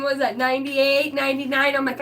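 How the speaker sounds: ordinary talk, nothing marked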